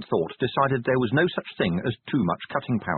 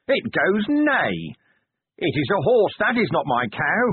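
slow, higher, tearful reciting; dazed storybook voice; 6 semitones higher than usual. A narrator's reading. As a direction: recite it like the children's book, lost. Dazed Vimes reciting the picture book.

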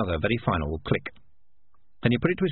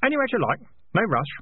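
high-pitched, obliging tone; helpful; 9 semitones higher, faster than usual. Acted. Keith prompting the failing piper, high and helpful. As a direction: reassure him all too obligingly. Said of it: "said Keith helpfully".

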